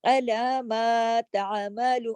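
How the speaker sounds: slow drawn-out tajweed chant; measured Quranic recitation. Shown as a demonstration. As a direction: recite it slowly, stretching the vowels. Teacher recites Quran phrase.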